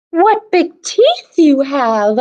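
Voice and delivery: put-on a girl startled by sharp teeth; frightened cry.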